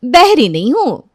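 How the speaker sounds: loud, drawn-out, high-pitched irritated comeback; irritated retort. Acted. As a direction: snap back slowly and loudly, fed up. Irritated catchphrase opening the story. (चिढ़कर, खींचकर ज़ोर से)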